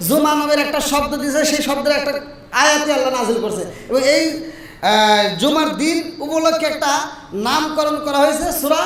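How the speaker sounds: normal talking voice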